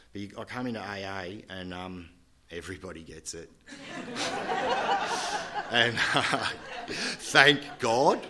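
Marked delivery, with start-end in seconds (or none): 3.5-5.6 big high laugh breaking out; high burst of laughter. Real, not acted. Relieved laugh to audience. 5.7-8.2 laughing through the words, louder; laughing thanks. Real, not acted.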